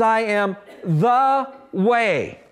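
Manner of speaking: "the" slowly leaned on, the word itself weighted; stress on THE. Shown as a demonstration. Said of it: "Notice how Jesus uses the definite article".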